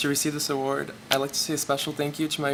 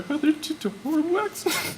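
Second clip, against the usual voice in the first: voice breaking; 12 semitones higher than usual. Real, not acted. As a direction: voice cracks up high, close to tears. Recalling brother's death, voice breaking.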